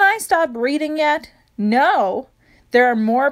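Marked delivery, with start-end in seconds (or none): none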